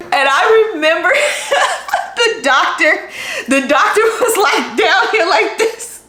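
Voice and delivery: can't stop laughing while telling it. Real, not acted. Laughing while recalling doctor.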